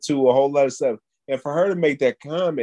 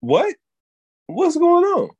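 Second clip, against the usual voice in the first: surprised; 4 dB louder, slower than usual. Pretend. Startled at old lady.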